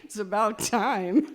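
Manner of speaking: slow, loud, laughing; laughing retort. Retold or imitated. Daughter replying to her dad, laughing. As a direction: laugh out the comeback.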